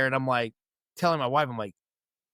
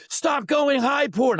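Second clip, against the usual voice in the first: high, loud, slow drill-sergeant command; barked order; 12 semitones higher, 4 dB louder, slower than usual. Retold or imitated. Sergeant correcting a soldier's rifle carry, in a slow high bark. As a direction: hammer each word like a sergeant.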